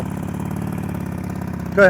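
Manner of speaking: ordinary talk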